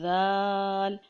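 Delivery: ذال drawn long with a soft "th" as in "this"; tongue lightly on teeth, stretch ذال gently; soft zaal. Shown as a demonstration. Teacher demonstrating the letter, long soft ذال. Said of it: "ذال کو بھی ہم نے بالکل اسی طریقے سے پڑھنا ہے".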